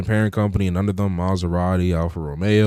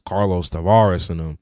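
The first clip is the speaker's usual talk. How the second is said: usual speaking voice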